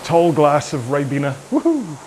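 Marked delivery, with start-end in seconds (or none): 1.5-2.0 excited whoop. Real, not acted. Excited about spaghetti dinner.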